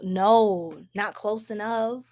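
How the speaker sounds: ordinary talk, nothing marked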